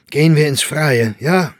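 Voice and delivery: loud, high call to the group; brisk proposal. Acted. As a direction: urge everyone to leave, ending in a question. Calling friends to leave. (lauter, auffordernder Vorschlag)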